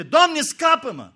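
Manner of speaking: loud, high, slow cry for help; desperate cry. Acted. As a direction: cry out as if drowning. Peter sinking cries out. (strigăt disperat după ajutor)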